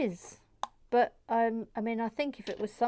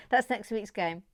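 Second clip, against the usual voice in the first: talking through giggles; real, bubbling laughter around the words; 3 semitones higher, 4 dB louder than usual. Real, not acted. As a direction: break into giggles between phrases. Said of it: "got real giggles today".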